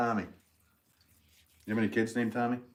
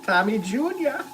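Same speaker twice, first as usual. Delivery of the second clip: loud, high Boston call; hammed-up Boston accent, voice pitched up; 13 semitones higher, 8 dB louder than usual.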